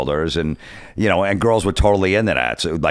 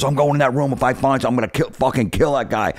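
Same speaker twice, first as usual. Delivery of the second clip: higher-pitched imitation of a furious boyfriend; retold angry threat; 7 semitones higher than usual. Wrestler threatens to search her room, mild mock yell. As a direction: act out the threat without really shouting. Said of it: "they're screaming out there".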